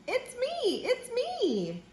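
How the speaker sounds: high, light singsong; hen character. A narrator's reading. Hen revealing who is under the mask, high light singsong. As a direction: pipe it up softly and high. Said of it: "says the hen".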